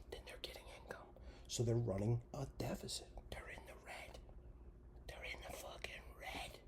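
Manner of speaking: fading to a soft hush; dramatic whisper. Real, not acted. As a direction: whisper the bad news. Grim budget explanation.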